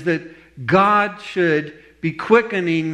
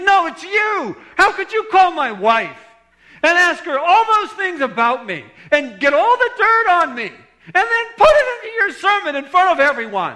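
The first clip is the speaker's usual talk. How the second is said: imitating a ranting accuser, loud and very high; livid, out of his mind; 14 semitones higher, 6 dB louder than usual. Man accusing the pastor of exposing him in a sermon, acted livid. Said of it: "out of his mind".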